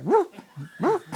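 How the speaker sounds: high popping whoop sound; whooping teeth noise. Retold or imitated. Teller mimicking the old man's teeth popping, high whoop. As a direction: imitate false teeth slipping out. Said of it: "the teeth coming in and out".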